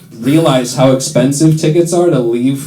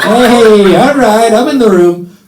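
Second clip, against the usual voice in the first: laughing call-out; 5 semitones higher, 5 dB louder, slower than usual. Real, not acted. Reacting to crowd laughter.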